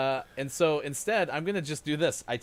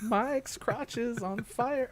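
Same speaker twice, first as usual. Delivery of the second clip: slow mock dramatic; 11 semitones higher, much slower than usual. Misheard joke read slowly.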